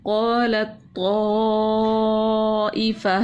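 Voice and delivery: very slow, drawn-out chant; slow recitation. Shown as a demonstration. Teacher showing how letters join, very slow chant. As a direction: stretch the words out in recitation. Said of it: "direct तौ से मिला दिया".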